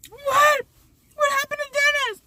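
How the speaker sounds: high, wailing, near-crying mother; crying panicked mom. Retold or imitated. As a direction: wail for your missing son.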